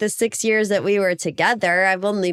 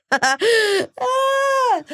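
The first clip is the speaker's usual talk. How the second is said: usual speaking voice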